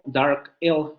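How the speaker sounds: "dark L" drawn out slowly, in English; English term slowly. Shown as a demonstration. Teacher naming the dark L in English. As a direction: stretch out "dark L". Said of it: "lo que se conoce como".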